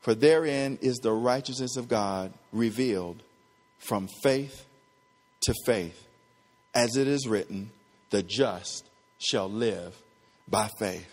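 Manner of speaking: low, soft, measured reading; solemn scripture reading. Real, not acted. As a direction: read the verse quietly, phrase by phrase. Reads the verse aloud.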